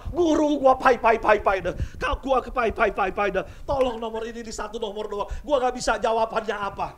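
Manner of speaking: loud, high begging student; hammed-up desperate exam student begging; pleading loudly. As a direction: beg the teacher loudly for one answer.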